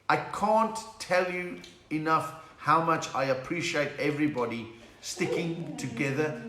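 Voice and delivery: ordinary talk, nothing marked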